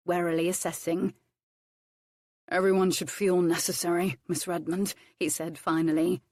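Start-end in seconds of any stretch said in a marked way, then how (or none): none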